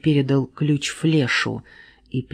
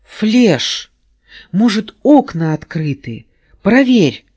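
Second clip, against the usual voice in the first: higher, louder command; 6 semitones higher, 6 dB louder than usual. A narrator's reading. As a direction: bark out orders. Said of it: "скомандовал Шустрик".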